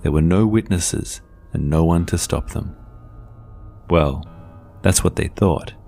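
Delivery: ordinary delivery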